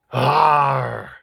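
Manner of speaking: loud creature grunt of Arr. Acted. Agnew the creature replies to host with loud Arr. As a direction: Agnew grunts his point. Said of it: "And I can't believe".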